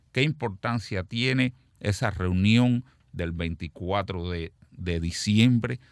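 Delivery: ordinary talk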